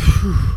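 loud, blown-out breath; demonstrative sigh of release; calming exhale. Shown as a demonstration. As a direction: blow out a big relaxing breath.

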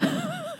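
very high, soft mock yowl; a tiny imitated cat cry; cat wail. Cat found crying in hallway.

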